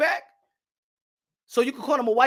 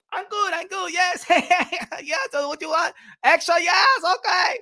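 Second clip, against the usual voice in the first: high-pitched, eager caricature with a giggle; Chinese takeout man; 5 semitones higher than usual. Acted. Chinese restaurant man taking a regular's order, high eager caricature. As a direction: chirp back cheerily in a put-on high voice.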